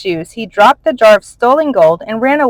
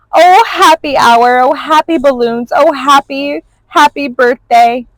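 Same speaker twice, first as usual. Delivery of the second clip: high, louder rapturous exclamations; overjoyed exclaiming; 6 semitones higher, 4 dB louder than usual. A narrator's reading. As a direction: gush with pure happiness. Dragon overjoyed at balloons.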